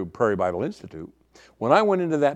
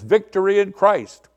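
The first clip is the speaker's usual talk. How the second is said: higher, louder street greeting; 7 semitones higher, 5 dB louder than usual. Retold or imitated. Student hailing a passerby, imitated zealous greeting. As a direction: call it out to a passing believer. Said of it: "You'd go like this".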